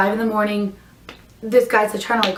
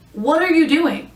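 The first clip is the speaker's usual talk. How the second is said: exasperated; 6 semitones higher than usual. Retold or imitated. Indignant at driver flirting on job.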